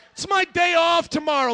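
high, loud complaint; indignant protest. Retold or imitated. Husband protesting to wife about his day off, high indignant shout. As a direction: whine loudly that this is unfair.

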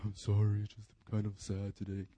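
teasing the youth group in a mock sad mumble; mock sad crowd. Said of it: "You guys are all like".

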